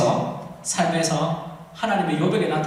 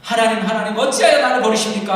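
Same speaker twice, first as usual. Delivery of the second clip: forsaken cry; 3 semitones higher, 8 dB louder than usual. Acted. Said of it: "얘기하잖아".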